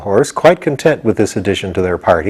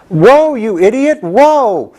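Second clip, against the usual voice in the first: higher, slow yelled call to a runaway horse; calling after a bolting horse, slow, high yell; yelling whoa; 11 semitones higher, much slower than usual. A narrator's reading.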